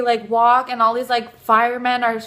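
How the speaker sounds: ordinary delivery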